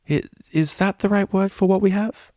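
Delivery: put-on hesitant young mare; shy mare voice. A narrator's reading. Octavia's shy reply.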